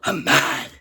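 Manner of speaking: low mad. Real, not acted. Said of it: "I'm already pissed".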